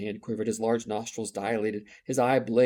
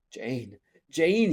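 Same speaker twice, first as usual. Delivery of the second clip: two calls of Jane, the second higher and louder; bitter sadness; 3 semitones higher, 4 dB louder than usual. Acted. Rochester calling Jane's name in mild bitter sadness. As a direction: plead her name with a touch of bitterness. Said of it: "accent of bitter sadness".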